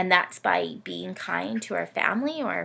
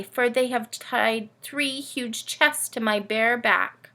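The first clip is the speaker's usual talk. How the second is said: put-on lively read-aloud for kids, camel telling its tale; storybook reading; 4 semitones higher, 5 dB louder than usual. A narrator's reading. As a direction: tell it like a bedtime picture book. Camel narrator reads story.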